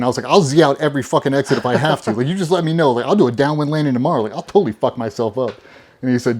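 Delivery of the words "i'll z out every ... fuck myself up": loud, higher, insistent rush; emphatic retold vow. Trainee told he might miss Red, emphatic vowing. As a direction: swear you will do anything. Retold vehement threat to cadre.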